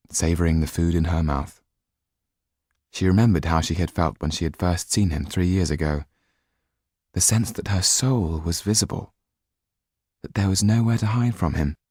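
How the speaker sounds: ordinary delivery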